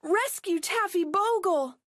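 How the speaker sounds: high desperate begging; pleading cry. Acted. Millie begs the helicopter pilot to save the dog, high plea. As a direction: beg the helicopter to help. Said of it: "cried Millie".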